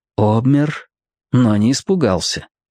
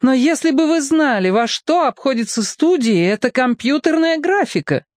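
exasperated director; 13 semitones higher than usual. A narrator's reading. Director voiced.

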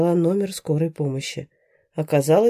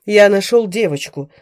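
louder desperate yell; straining shout; 3 semitones higher, 8 dB louder than usual. A narrator's reading. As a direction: yell with all your strength. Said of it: "надрывался мужчина".

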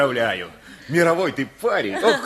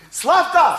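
calls up the yard, high and louder; calling out; 8 semitones higher, 4 dB louder than usual. Acted. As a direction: summon Slavka from outside, cheerful.

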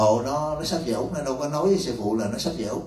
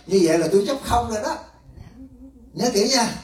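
higher, driving sermon voice; emphatic preaching; 9 semitones higher than usual. Real, not acted. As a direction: press the point home. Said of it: "preaching emphasis". (giảng nhấn mạnh, giọng cao)

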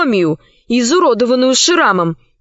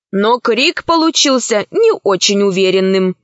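ordinary delivery, nothing marked

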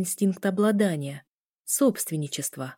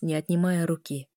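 plain reading voice

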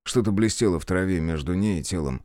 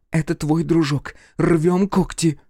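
tense, higher, slow getaway order; urgent whisper-hiss; 7 semitones higher, 3 dB louder, slower than usual. Acted. Sonka urgent after killing.

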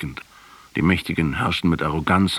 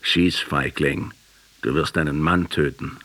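defiant last words. Retold or imitated.

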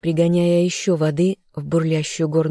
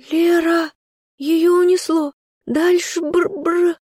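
drowning; 12 semitones higher, slower than usual. Acted. Girl drowning calls for friend.

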